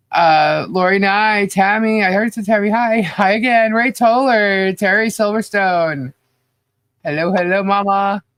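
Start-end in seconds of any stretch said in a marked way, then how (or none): none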